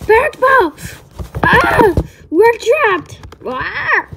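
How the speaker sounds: high, loud frightened cries; trapped, yelling for Parrot Ball; panicked yelling. Acted. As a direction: cry out to your friend in terror. Calling friend in panic.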